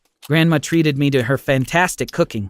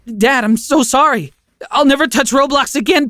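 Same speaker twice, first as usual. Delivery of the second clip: begging; 11 semitones higher, 4 dB louder than usual. Acted. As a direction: beg Dad desperately, voice pitched up. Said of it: "I begged".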